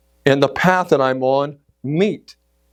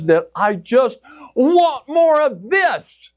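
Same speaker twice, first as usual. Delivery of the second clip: high, stretched-out yearning; soaring, slow and eager; rapturous longing; 11 semitones higher, slower than usual. Retold or imitated. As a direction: draw it out with irresistible desire. Reliving an irresistible urge.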